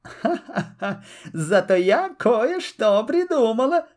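sweet, lilting high voice after a little laugh; almost sing it, drawing it out; honeyed fox sing-song; sing-song sly fox. A narrator's reading. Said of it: "почти пропела лиса Алиса".

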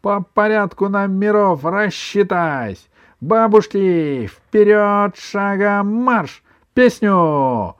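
loud, high parade-ground orders; drawn-out drill commands; commanding. A narrator's reading. Grandson drilling an imaginary army of grandmothers, commanding. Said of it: "скомандовал он".

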